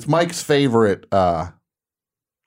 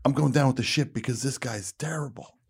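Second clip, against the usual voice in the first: pleading; 3 semitones higher, 9 dB quieter than usual. Retold or imitated. Actor going down with the ship, retold as a quiet plea. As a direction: plead under your breath, as if signalling for rescue. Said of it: "almost pleading".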